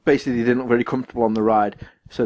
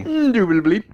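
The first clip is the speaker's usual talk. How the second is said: high, mock-posh English agreement; English squire accent; 9 semitones higher than usual. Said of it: "proper good English squires".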